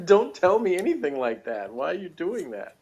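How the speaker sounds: playful imagined telling-off; mock protest. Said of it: "I'd be like".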